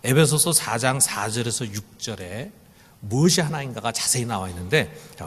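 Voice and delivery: normal talking voice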